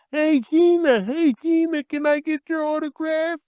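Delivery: high whiny imitation of pestering kid fans; mocking kid fans. Wrestler mocking kid fans begging him, high and whiny. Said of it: "I'm tired of them coming up to me saying".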